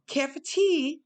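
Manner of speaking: a playful posh British "care for tea" with the syllables swinging up; mock British accent. Shown as a demonstration. Vlogger showing how Brits sound to Americans, in a hammed-up British accent. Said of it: "because the syllables go up".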